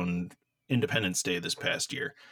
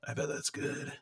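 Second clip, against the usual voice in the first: creepy voice; 4 semitones higher, 7 dB quieter than usual. Pretend. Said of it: "Unlike this voice that you're doing".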